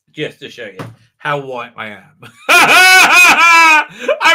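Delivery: real laugh breaking into a loud line; laughing through words. Real, not acted. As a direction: say it while cracking up. Laughs at own joke.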